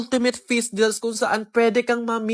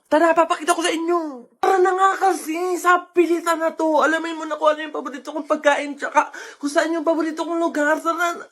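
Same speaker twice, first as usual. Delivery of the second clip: playful urging; 5 semitones higher than usual. Acted. Pulling viewers along.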